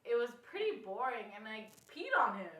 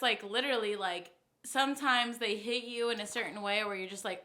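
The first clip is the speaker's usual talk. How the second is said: usual speaking voice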